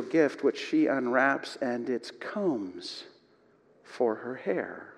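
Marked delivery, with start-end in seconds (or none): none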